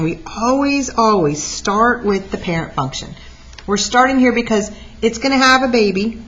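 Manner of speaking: normal talking voice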